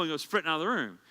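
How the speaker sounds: usual speaking voice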